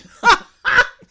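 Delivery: high, loud burst of laughter. Real, not acted.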